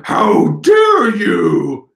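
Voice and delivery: very slow, loud monster roar; outraged villain. Acted. Villain roaring at his captor, drawn-out furious shout. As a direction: bellow it out, stretching every word. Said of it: "He's going".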